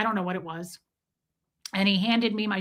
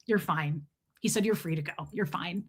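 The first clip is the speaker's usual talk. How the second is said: lower, softer, a hint of an icy officer; cold officer; 5 semitones lower, 6 dB quieter than usual. Icy officer dismisses the traveler, low and soft. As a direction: brush her off, low and cold. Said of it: "this dude was cold as ice".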